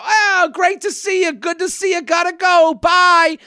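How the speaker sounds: high-pitched, over-friendly hello and goodbye; breezy brush-off greeting. Acted. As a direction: chirp a polite greeting while escaping. Acting out a quick polite brush-off.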